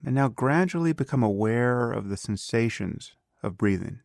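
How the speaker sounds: calm, unhurried meditation guide with long pauses; guided meditation. Acted. Teacher leading a beginner through breath awareness, calm guided meditation.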